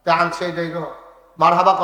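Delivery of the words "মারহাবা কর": raised-voice cue to the crowd; loud prompt. Real, not acted. Preacher asks crowd for marhaba, loud prompt. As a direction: prompt the audience loudly.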